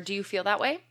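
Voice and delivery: usual speaking voice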